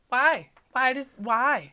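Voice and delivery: loud, high, indignant cry of why; exasperated why. Real, not acted. Reacting to the story, an outraged loud why. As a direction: protest it at the top of your voice.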